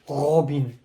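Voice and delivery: put-on name said with a German accent; imitated German-accented call; German pronunciation. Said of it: "somebody called him in German".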